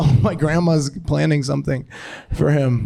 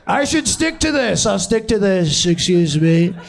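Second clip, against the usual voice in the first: hammed-up presidential impression, raised pitch; Trump; 3 semitones higher than usual. Comic plays president razing Busch Gardens, raised mock-politician voice. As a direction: pronounce it like a self-pleased politician mid-speech. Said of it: "Trump just".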